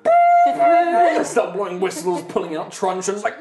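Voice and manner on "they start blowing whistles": whistle imitation. Guards blowing whistles.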